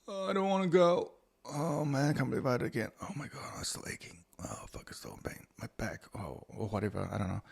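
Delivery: fading from a moan to an unvoiced whisper; whiny groaning self. Retelling his sore morning after gym, whining into a whisper. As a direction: groan it, then whisper the aches. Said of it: "I was like".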